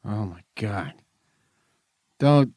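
usual speaking voice

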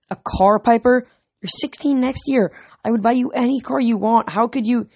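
father's exasperated rebuke; upset father. A narrator's reading. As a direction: scold her in hurt disbelief. Dad angry about stolen car.

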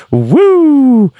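high, loud drawn-out woo; Ric Flair woo. Pretend. Imitating Ric Flair's trademark woo, loud and high. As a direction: belt out a signature wrestler's woo. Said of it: "be like".

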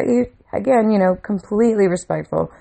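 usual speaking voice

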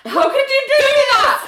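high, loud indignant wail; hammed-up cry of horrified blame; mock outraged accusation. John accuses Nell in retold quarrel.

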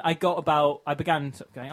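ordinary talk, nothing marked

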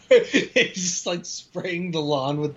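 cracking up while describing it; laughing. Real, not acted. Co-host jokes about the garden hose, laughing. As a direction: talk through real giggles.

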